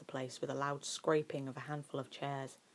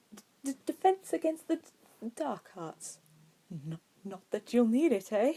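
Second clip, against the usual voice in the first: higher, nervous stammering voice; stuttering professor; 7 semitones higher, 4 dB louder than usual. A narrator's reading. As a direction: stammer as if scared of the words.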